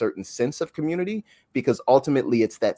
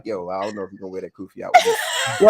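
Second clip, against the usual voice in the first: laughing; 3 semitones lower than usual. Retold or imitated. Retelling wife's teasing, laughing.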